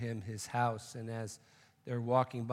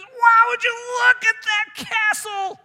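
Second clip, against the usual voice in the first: much higher, much louder, slowed wonder; awed shout; 17 dB louder, slower than usual. Acted. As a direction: bellow it in amazement. Bud sees the heavenly castle.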